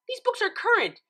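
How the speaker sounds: high, loud snap; snapped. A narrator's reading. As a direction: snap back, defensive. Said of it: "Twilight snapped".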